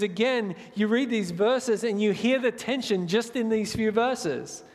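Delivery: ordinary talk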